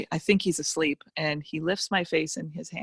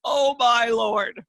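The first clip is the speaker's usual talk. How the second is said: stunned exclamation; 5 semitones higher, 6 dB louder, much slower than usual. Retold or imitated. Disbelief at her chaotic life.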